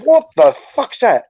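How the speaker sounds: high, slow, shocked cry at a bang; startled yelp of alarm in the night; startled outburst. Retold or imitated. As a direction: jump at the noise and shout out. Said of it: "I'm like".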